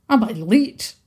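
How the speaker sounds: put-on haggis creature in a thick Scottish accent; fussy little beastie with a thick Scottish accent. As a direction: ask it in a broad Scots brogue. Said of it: "decipher his thick accent".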